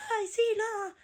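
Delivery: high mincing mock voice of an effeminate boy; effeminate mimic. Mocking the effeminate classmate, high mincing voice.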